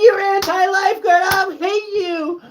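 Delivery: high mock shout into fake crying, slowing; mock tantrum. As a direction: wail it like a toddler's tantrum. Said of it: "You're like".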